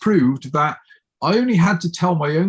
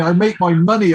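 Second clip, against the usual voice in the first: usual speaking voice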